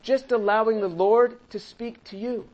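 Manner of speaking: normal talking voice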